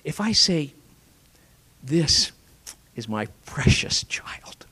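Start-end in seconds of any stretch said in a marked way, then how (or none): 1.8-4.7 a hushed loving aside; tender whisper. Shown as a demonstration. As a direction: breathe it like cradling a child. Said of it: "that's teknon".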